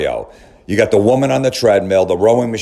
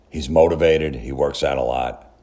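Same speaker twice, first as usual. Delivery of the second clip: lower, softer mock praise; sarcastic mutter; 6 semitones lower, 3 dB quieter than usual. Shown as a demonstration. Mocking the gym hero to the listeners, lower and softer. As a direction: drop into dry sarcasm on "works out a lot". Sarcastic list of his virtues.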